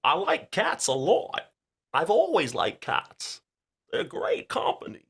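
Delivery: a slow, strange-pitched Walken bit; Christopher Walken impression. Shown as a demonstration. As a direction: drag out the words like Walken. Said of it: "let me show you how Christopher Walken goes".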